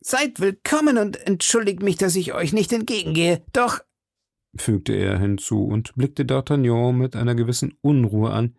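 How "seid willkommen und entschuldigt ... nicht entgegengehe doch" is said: high-pitched, hearty character voice; boastful musketeer, pitched well up; Porthos. Acted. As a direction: welcome a friend in a high, effusive voice. Porthos's voice in read story.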